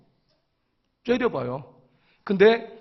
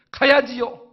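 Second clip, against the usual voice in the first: sudden higher, eager reply; retold eager agreement; 4 semitones higher, 5 dB louder, slower than usual. Elder hearing the church is training new elders, instantly eager. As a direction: jump at the offer after refusing.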